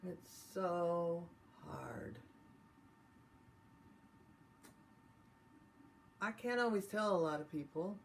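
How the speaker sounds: venting frustration, paced with long pauses; tapping script. Acted. Leads tapping round phrases.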